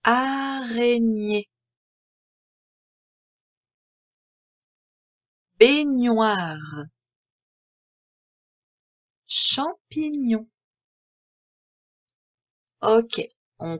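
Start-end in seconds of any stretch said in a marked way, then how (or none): 0.0-10.5 each word stretched very slowly, syllable by syllable; slow dictation. Shown as a demonstration. Teacher dictating the words of activity two, very slow. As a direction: lay out every syllable so the gne stands out. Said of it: "Je coche la case quand j'entends le son gne".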